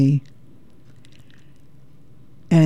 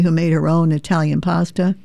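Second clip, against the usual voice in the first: ordinary talk, nothing marked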